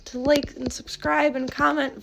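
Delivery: high, fast, loud voicing of the cat; cat's voice. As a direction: speak for the cat like an eager little youtuber. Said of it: "Voya, we're not on YouTube".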